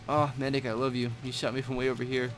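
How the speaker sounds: usual speaking voice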